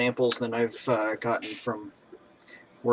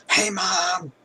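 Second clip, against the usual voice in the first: high, retold scream for mom; mock scream; 9 semitones higher, 4 dB louder than usual. Retelling calling out to mom, screaming. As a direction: holler it, pitched way up. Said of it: "I would just scream".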